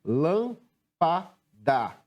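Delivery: "Lâmpada" very slowly, a pause after each syllable; separando sílabas. Shown as a demonstration. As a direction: break "Lâmpada" into three separate beats. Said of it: "Como é que separamos a palavra lâmpada?". ("Lâmpada" pausado, sílaba por sílaba)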